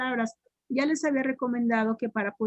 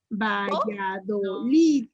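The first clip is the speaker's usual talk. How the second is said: "Valladolid" stretched syllable by syllable, LID stressed; slow syllable-by-syllable; 3 semitones lower, much slower than usual. Shown as a demonstration. Teacher correcting a wrong guess, "Valladolid" slowly split with LID stressed. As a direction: spell out the sounds of "Valladolid" slowly, ending on a strong LID. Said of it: "la pronuncien por sílabas despacito".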